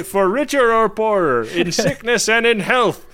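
high-pitched, hammed-up vow recital; mock screaming. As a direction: belt out the vows in mock frenzy. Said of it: "what Worf is screaming out to himself".

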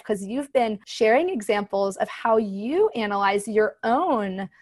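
ordinary delivery

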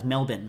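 Melbourne said as MEL-bin; Melbourne ending in a short "bin"; local pronunciation. Shown as a demonstration. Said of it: "which is pronounced Melbourne".

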